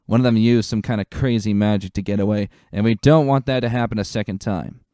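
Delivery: rally the troops with a firm officer's call; commanding lieutenant. Acted. Lieutenant ordering stallions to join the forest search, loud and commanding.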